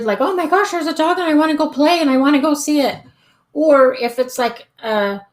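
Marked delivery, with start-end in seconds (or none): 0.2-3.0 fast, high, breathless puppy excitement; excited dog. Retold or imitated. Trainer voicing her excited dog who spots another dog. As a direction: gush like an eager dog spotting a friend. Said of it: "like, an excited".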